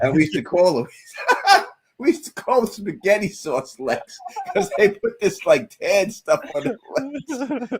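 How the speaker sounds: high voice breaking into laughter; laughing while telling. Real, not acted. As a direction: talk through giggles, barely holding it. Laughing through a funny memory.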